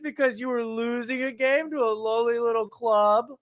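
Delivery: high, sing-song mock pity, slowed on poor Liverpool; mocking taunt. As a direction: taunt rival fans with fake sympathy. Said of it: "everybody else is like".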